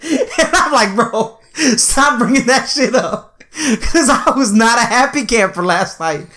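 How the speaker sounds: high, giggly protest; amused retelling broken by laughs; laughing while talking. Real, not acted. Laughing through the next-day joke.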